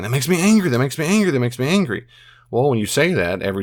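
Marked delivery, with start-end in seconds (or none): 0.0-2.1 higher pitch, fading down each repeat; habitual angry repeating. Retold or imitated. Recalling his old habit of saying angry, repeated. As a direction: repeat it like a reflex, winding down. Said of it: "I got in the habit of saying".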